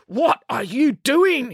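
slow, indignant challenge; demanding. A narrator's reading. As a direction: stretch out each word, demanding an answer. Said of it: "he demanded".

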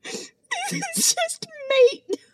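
very high, slow wheeze of laughter; cracking up. Real, not acted. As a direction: drag it out, helpless with real laughter. Still cracking up repeating it.

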